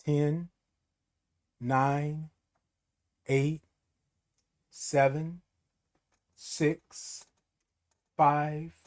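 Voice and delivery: slow, soft counting down; calming countdown. Real, not acted. Calming herself down after an outburst, soft slow counting. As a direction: count yourself back to calm. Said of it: "counts down to calm".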